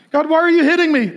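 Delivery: quick, high mock protest; imitated complaint. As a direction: act out a wronged child pleading upward. Believer complains to God.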